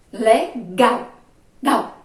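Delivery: "Legal" split and loud, with "Gal" repeated forcefully; strong final syllable. Shown as a demonstration. Teacher showing the whole syllable is strong, loud stress. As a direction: punch "gal" in "Legal", then say it alone. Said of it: "Ela todinha foi forte".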